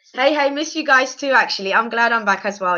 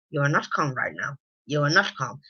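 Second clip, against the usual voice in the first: lower, softer, condescending husband voice; controlling husband; 9 semitones lower, 8 dB quieter than usual. Retold or imitated. Husband telling wife she is not calm, low soft patronizing. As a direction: talk her down low and patronizing. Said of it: "even the way he speaks to her".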